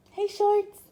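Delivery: high, loud dog-greeting voice; talking to a dog. Real, not acted. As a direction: call the dog brightly. Calling the dog.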